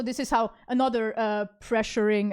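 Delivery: ordinary delivery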